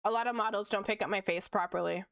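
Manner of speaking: usual speaking voice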